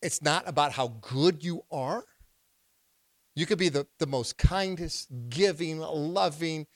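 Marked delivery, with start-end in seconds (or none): none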